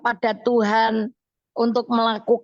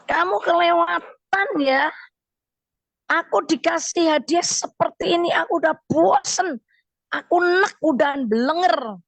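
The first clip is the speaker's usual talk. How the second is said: much higher, indignant mock scolding; wife scolding husband over a fish-head gift, higher mock anger; angry wife; 6 semitones higher than usual. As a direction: pitch it up like a fed-up wife. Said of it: "istrinya marah sekali".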